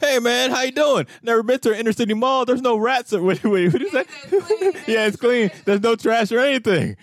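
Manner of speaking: mock boyfriend voice. Boyfriend quoted in movie retelling.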